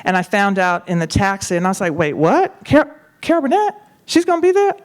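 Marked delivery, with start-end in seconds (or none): 1.9-4.7 much higher, squeaky stammering surprise; retold double-take, rising squeal; shocked disbelief. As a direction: climb up in pitch as it sinks in. Shocked to hear of the guest.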